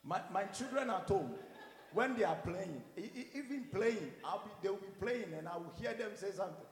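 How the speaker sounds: normal talking voice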